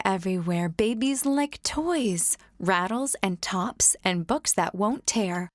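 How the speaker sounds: plain reading voice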